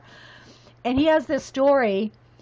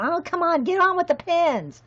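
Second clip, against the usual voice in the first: higher-pitched mock impatience; impatient listeners; 6 semitones higher than usual. Imagined viewers tired of the rabbit trail, mock impatient. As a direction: imitate restless viewers urging you on. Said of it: "I know people are going".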